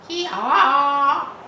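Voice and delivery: high, very loud bleat; bleating sheep. Acted. Sheep answer the roll together, loud bleat. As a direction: bleat it out as a flock. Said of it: "answered the sheep all together".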